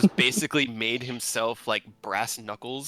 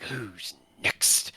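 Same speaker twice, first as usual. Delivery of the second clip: menacing whisper; 5 semitones lower than usual. Acted. Eric menacing after kick.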